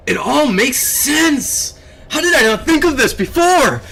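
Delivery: high, slow, thrilled cry of discovery; ecstatic. Real, not acted. To chat, ecstatic about a theory. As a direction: squeal it out like a eureka moment. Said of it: "am I being too ecstatic with emotions here".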